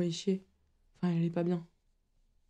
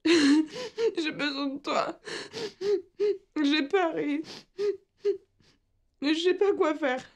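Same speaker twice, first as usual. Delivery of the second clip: high, tearful voice between gasping sobs; words forced out through sobs; sobbing plea; 9 semitones higher than usual. Acted. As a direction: break down crying, begging for comfort. Breaks down crying, begging mother.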